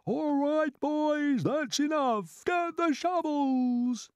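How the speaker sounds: high, loud drawn-out holler; shouting. A narrator's reading. Cloud man ordering his gang to work, high shout. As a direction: call out to the whole crew. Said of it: "they heard him shouting".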